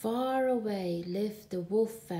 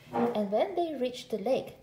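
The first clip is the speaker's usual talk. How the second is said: usual speaking voice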